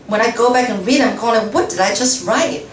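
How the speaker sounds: usual speaking voice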